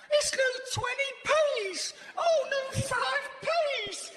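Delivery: put-on high-pitched squeal of childish wonder; hammed-up little kid, thrilled and drawn out; excited child. Man left alone marvelling at new coins, excited child. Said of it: "I recede to the mentality of a child".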